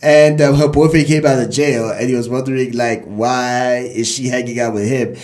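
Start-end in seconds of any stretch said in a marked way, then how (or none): none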